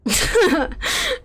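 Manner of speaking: a high rising squeal of laughter. Real, not acted. Streamer squealing while trying on glasses. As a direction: let a high giggle rise out. Laughing at character creator look.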